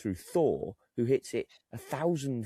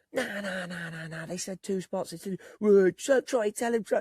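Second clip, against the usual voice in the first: mock crowd protest; 9 semitones higher, 4 dB louder than usual. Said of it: "everyone on this side and my side goes".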